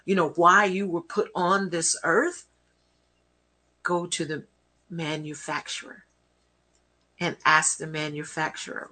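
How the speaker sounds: ordinary talk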